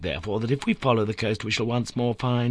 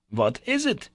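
high, bewildered question; astonished cry; 7 semitones higher than usual. A narrator's reading. Professor startled by his nephew's find, astonished cry. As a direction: ask it with startled confusion. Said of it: "cried the astonished and bewildered professor".

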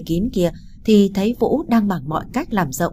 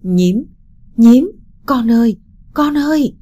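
a mother's anguished, sobbing call, higher and louder; sobbing cry; 5 semitones higher, 8 dB louder than usual. A narrator's reading. Mother sobbing her injured daughter's name, high and loud. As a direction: cry out the child's name through sobs. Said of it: "những đợt nức nở liên tiếp".

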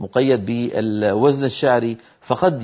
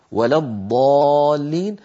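slow recitation; slower than usual. Shown as a demonstration. Reciting the verse.

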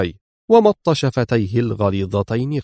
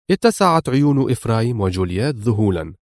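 plain reading voice

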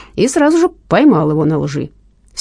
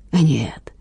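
whispering; 3 semitones lower, 4 dB quieter than usual. Acted. Killer answers in dark scene.

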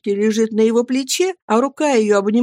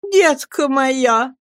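tender pleading; 6 semitones higher, much slower than usual. A narrator's reading. Mother pleads with son.

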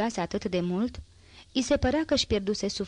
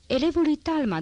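boastful exclamation; 8 semitones higher, 4 dB louder than usual. A narrator's reading. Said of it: "Exclamă cu un glas care amintea totodată îngânfarea scamatorului".

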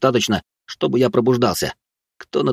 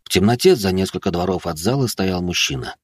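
plain reading voice

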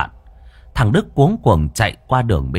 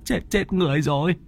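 stammering; 9 semitones higher than usual. Acted. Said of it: "Mồm nó lắp bắp".

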